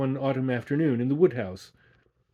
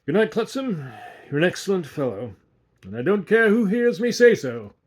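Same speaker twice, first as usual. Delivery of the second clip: raised, higher and louder goodnight; loud tipsy farewell; 7 semitones higher, 7 dB louder than usual. A narrator's reading. Tipsy guest proclaiming his goodnight to the waiter, loud and high. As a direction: boom it out, a little drunk.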